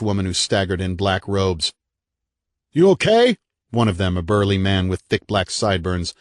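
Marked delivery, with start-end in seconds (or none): 2.7-3.4 loud shout over noise; yelling. A narrator's reading. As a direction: call out over fire noise. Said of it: "yelled above the noise".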